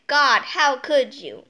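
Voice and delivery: loud, slow mock scolding; mock outrage. Kid scolding whoever knocked Uranus over, loud mock outrage. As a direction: scold the kicker in hammed-up outrage.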